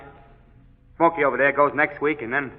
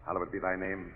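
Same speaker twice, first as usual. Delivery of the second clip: murmured prayer; 6 semitones lower, 4 dB quieter than usual. Acted. Chaplain prays on last walk.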